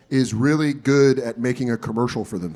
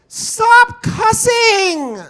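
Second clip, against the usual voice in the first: very high, drawn-out nerdy yell; nerd yelling out; much slower than usual. Retold or imitated. Nerdy kid scolds friends from his bike, yelling. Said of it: "I hear the nerd of the group just yell out".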